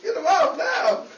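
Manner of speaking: loud demand, startled; frightened woman yelling about a dog; urgent shout. Real, not acted. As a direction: yell for someone to pull the dog away. Dog jumping on her.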